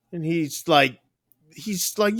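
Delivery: usual speaking voice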